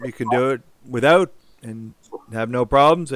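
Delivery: ordinary delivery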